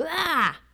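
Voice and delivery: high, drawn-out mock yell; imitated child's grunt. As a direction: cry out like a toddler demanding something. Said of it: "or he goes".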